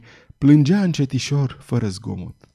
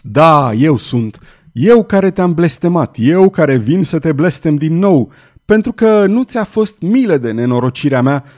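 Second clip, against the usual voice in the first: loud, high, fierce cursing; furious curse; 4 semitones higher, 7 dB louder than usual. A narrator's reading. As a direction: hurl the curse at him. Father cursing the executioner. (blestem furios)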